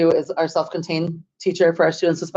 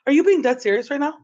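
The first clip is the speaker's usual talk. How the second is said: high-pitched, incredulous check; retold disbelief; 8 semitones higher, faster than usual. Speaker reacting to the house-building offer, high and amazed.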